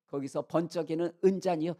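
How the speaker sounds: ordinary delivery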